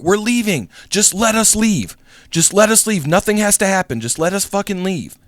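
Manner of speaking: high-pitched, insistent mock pleading; terrified pleading. Driver pleads with the gunman to let them leave, terrified. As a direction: beg to be let go, imitating a scared driver. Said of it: "was also terrified".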